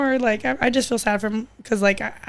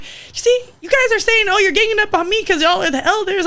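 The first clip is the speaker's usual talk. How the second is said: defensive; 8 semitones higher than usual. Retold or imitated. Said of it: "And I'm like".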